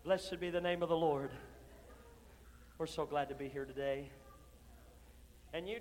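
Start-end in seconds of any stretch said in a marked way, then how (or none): 0.0-4.1 mock pious greeting. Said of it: "then you get out".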